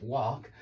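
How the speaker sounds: "walk" with an open ah, like "wahk"; American vowel attempt. Shown as a demonstration. Teacher's best effort at American speech, "walk" as wahk. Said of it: "that's my best effort at it".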